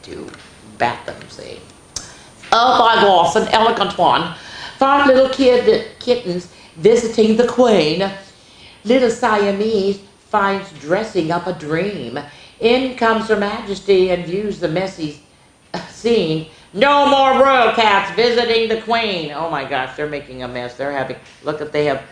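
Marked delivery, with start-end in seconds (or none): 2.5-4.4 higher, louder gushing; delighted gasp. Real, not acted. Gushing over book picture. 4.8-19.3 put-on lively read-aloud for young kids; sing-song picture book voice; storybook reading.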